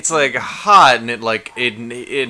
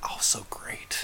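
whispering; 19 dB quieter, slower than usual. Real, not acted. Whispered aside.

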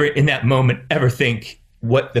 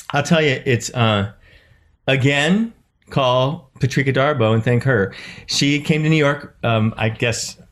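ordinary talk

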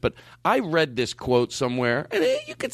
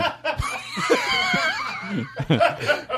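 big high laugh. Real, not acted. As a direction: let out a huge high-pitched laugh. Host bursts into a big laugh.

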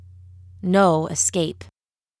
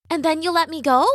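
put-on higher girl's voice, rising question; captive girl; 8 semitones higher, faster than usual. A narrator's reading. Captive asks the witch for freedom, in a higher questioning voice. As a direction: ask it high, like a young captive.

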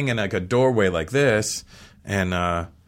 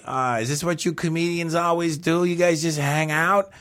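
[name] voice; 7 semitones higher than usual. Retold or imitated. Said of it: "I hear behind me".

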